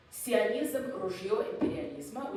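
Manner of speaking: "Sionizm ruzhyo imperializma" read out as a Russian phrase; Russian pronunciation. Shown as a demonstration. Lecturer reads the Soviet poster slogan aloud, "Sionizm ruzhyo imperializma" in Russian. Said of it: "I'll say it in Russian".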